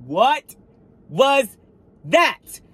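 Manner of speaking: high, loud, each word separated; stunned, spaced-out question; shocked disbelief. Retold or imitated. Reliving finding the bumper, high shocked disbelief.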